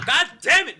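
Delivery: loud, high comic outburst; mock exasperated yell. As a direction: curse the friend who keeps tempting you. Acted outburst at friend's tempting messages.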